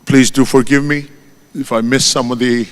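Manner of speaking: ordinary delivery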